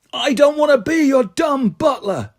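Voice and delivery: high, slow, shouted refusal; furious shout. A narrator's reading. Andy shouting at Terry he won't be his butler. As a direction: blow up at him.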